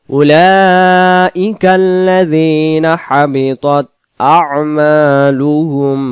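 slow melodic tajweed chant, long held vowels; chanted Quranic recitation. Shown as a demonstration. Teacher recites the verse.

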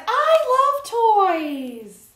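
high, loud, slow squeal of delight; excited Hamster. Acted. Teacher reading to kids, Hamster gushing over the toy store, high and drawn out. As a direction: stretch it out like a thrilled little hamster. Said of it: "Hamster says".

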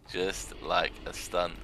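very slow and deadpan, each word spaced; slow sarcastic echo of the line before; slow dry repeat. Acted. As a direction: drag it out to land the joke. Repeats the phrase for effect.

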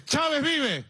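high, drawn-out mock cry; imitated shout. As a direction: mimic a woman calling out a slogan. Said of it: "la gente me grita".

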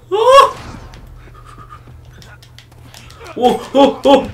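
loud startled scream; real panicked yell. Real, not acted. Ambushed in game, screaming.

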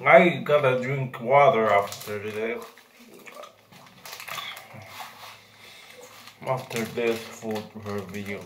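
talking through sour candy; chewing while talking. Real, not acted. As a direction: mouth partly full. Seen 2: Puts food in mouth at -12; jaw working, grimacing throughout while talking.

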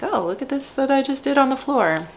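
put-on high, wondering little-kid voice; amazed toddler. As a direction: marvel at what you just did. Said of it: "being like".

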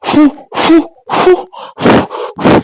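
sharp repeated puffs of breath; blowing out flames. Acted. Man puts out her burning sari, blowing hard. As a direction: blow hard in short bursts. Blows out the fire.

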